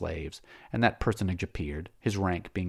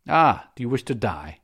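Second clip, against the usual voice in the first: louder, scornful challenge; a mocking taunt from a master; 4 semitones higher, 8 dB louder than usual. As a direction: sneer the question down at the slave.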